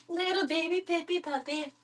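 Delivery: high squeaky pet voice; baby talk. Pretend. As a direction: squeak it at Pippi.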